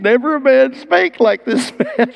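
"Never a man spake like this man" cried out high, with "spake" stressed; awed guards' exclamation. Retold or imitated. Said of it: "and they came back".

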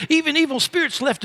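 excited report. Retold or imitated. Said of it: "they said".

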